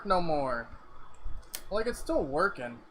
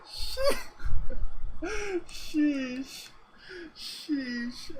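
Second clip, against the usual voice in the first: teary sheesh; 8 semitones higher, 5 dB louder, much slower than usual. Real, not acted. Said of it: "I'm crying again".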